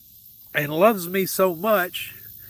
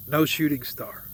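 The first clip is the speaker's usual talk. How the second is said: ordinary talk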